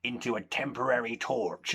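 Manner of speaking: normal talking voice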